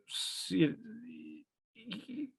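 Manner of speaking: real emotion closing the throat; choked up. Real, not acted. Telling a friend about his son, choked up. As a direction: voice catching, words breaking off. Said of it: "now I'm gonna get emotional".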